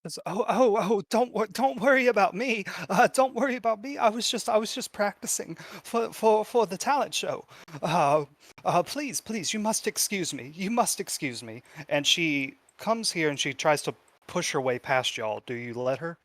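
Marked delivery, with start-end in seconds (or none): 0.2-11.6 high, stammering, hurried excuses; flustered girl. Acted. Girl caught practicing, begging to pass, high and flustered. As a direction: trip over words in a high anxious voice.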